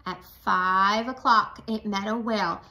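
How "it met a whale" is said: usual speaking voice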